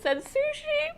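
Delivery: sushi in a sudden very high squeal; excited squeal. Real, not acted. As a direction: gush with delight on sushi. Proud mom reading son's list.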